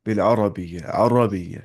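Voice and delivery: अरबिय्या said thick and heavy; heavy pronunciation. Shown as a demonstration. Teacher showing heavy pronunciation of अरबिय्या. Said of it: "मोटे बोल रहे हूँ, मोटे".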